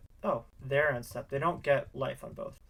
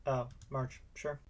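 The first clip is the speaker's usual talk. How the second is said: ordinary talk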